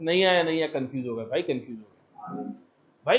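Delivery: a hint of a whiny confused kid; mock confused student. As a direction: play a lost, deflated student trailing off. Teacher mimics confused student.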